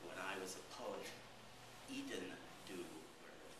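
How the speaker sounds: "When I was a poet" said low and soft; quiet poetic reading. Acted. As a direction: let it fall quietly, inward. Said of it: "same refrain read softly".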